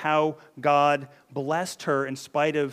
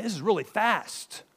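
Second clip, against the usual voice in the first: higher-pitched, impressed disbelief; amazed father; 6 semitones higher than usual. Retold or imitated. Isaac marvels at his son's speedy hunt, amazed.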